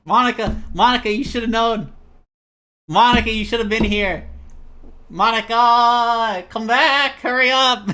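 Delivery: mock shouting. Calling out to Monica, hammed-up shout. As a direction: holler like calling across a field.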